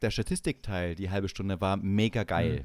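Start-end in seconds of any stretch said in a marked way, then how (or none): none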